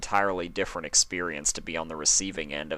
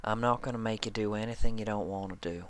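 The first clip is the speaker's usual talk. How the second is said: reassuring, quick; 3 semitones higher, 3 dB quieter, faster than usual. Acted. Applejack reassuring, far voice.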